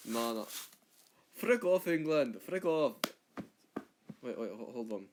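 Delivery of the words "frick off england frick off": loud, higher mock Scottish yell; angry Scottish shout. Comic Scot shouting off at England in a Scottish accent. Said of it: "Number one, Scottish".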